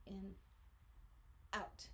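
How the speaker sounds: soft airy breath in and out; audible breathing demo. Shown as a demonstration. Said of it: "key component in diaphragmatic breathing".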